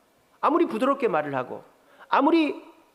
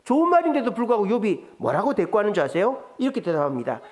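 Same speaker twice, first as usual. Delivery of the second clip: normal talking voice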